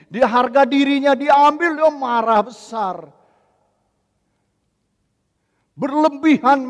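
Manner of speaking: loud, high sermon on wounded pride; heated preaching. Acted. Pastor warning the congregation about easy anger, heated preaching. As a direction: hammer the point home.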